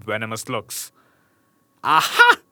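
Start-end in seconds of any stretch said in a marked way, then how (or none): 1.8-2.4 loud gloating aha; mocking cry. A narrator's reading. Witch triumphantly catches the prince, loud mocking aha. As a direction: crow over the prince. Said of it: "she cried mockingly".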